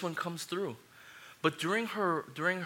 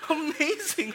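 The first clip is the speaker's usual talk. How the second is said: high, laughing rave over the food; laughing with joy at amazing food; laughing delight; 12 semitones higher, 7 dB louder than usual. Retold or imitated. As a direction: gush through a laugh. Retelling first taste of kamjatang.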